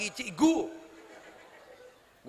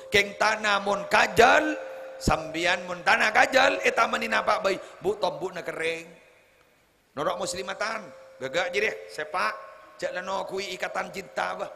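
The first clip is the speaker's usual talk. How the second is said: louder, higher preaching to the congregation; full-force preaching; 5 semitones higher, 8 dB louder than usual. Acted. Preacher urging mothers to join Muslimatan, full preaching voice. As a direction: drive the point home to the mothers. Preaching bad-soil contrast.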